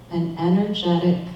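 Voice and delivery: ordinary talk, nothing marked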